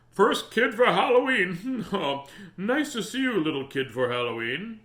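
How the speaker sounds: loud, high-pitched hearty greeting with a chuckle; big man greeting. A narrator's reading. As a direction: boom out a welcome at the door. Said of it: "A big man opened the door and said".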